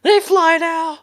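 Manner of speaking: high, loud film-quote yelp; hammed-up cry of disbelief; mock-alarmed exclamation. Echoing a film line in alarm.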